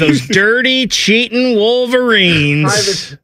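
heightened mock disdain. Host slamming the rival Wolverines harder, high, slow mock scorn. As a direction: stretch out each insult with relish.

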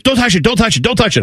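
high, rapid repeated pleading; imitated terrified child. Youngest guarding her burned foot, mock terrified. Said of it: "she is more terrified".

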